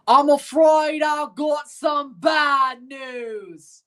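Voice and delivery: slow, high, loud British accent; hammed-up British accent, high and drawn out. Shown as a demonstration. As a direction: announce the bad news in a British accent. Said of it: "the only way I know how to do a British accent".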